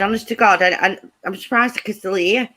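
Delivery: ordinary delivery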